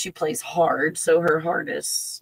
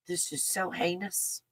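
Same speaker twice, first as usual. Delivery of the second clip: quiet disgust; 12 dB quieter than usual. Real, not acted. Quiet grim verdict after alert.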